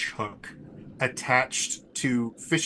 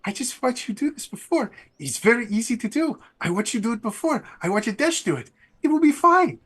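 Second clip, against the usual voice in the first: high, bubbly and carefree, much higher pitch; chirpy, breezy reassurance from an eager girl; Fubari's voice; 11 semitones higher than usual. Acted. Fubari reassuring the rider cheerfully, high bright character voice. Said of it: "And Fubari's just like".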